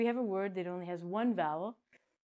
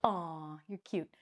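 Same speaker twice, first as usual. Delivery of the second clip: fond cooing; 3 semitones lower, slower than usual. Acted. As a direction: coo it gently, a little lower. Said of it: "and people say".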